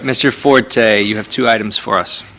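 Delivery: usual speaking voice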